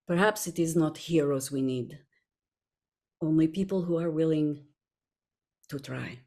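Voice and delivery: hushed speech, holding back "to try" until it drops away; big dramatic pauses. Acted. Actor quoting her character's long speech, with big dramatic pauses. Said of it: "I put in the big dramatic pause".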